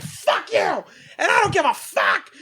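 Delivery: high-pitched, strained yell; mock furious yell. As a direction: hurl it like a retold screaming match. Retold woman yelling at someone inside.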